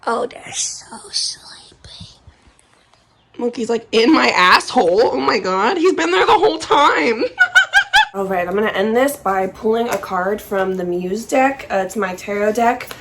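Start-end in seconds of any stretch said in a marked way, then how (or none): 0.0-1.4 soft slow whisper. Real, not acted. Cooing at sleepy animals. 5.8-8.1 high laughing shout. Real, not acted. Laughing surprise at monkey.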